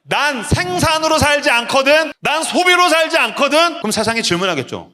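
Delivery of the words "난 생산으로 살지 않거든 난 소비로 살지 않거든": slow, high, rising to a shout; defiant declaration. Pretend. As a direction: proclaim it boldly to the world.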